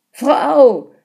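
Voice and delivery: high, loud joyful cry; excited shout. A narrator's reading. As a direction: call out to the wife, thrilled. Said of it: "schrie er begeistert".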